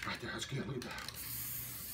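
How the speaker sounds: slow, breathless whisper; whispered in awe at the painting; awed whisper. Real, not acted. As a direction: hush it like a secret marvel. Awed at his own painting.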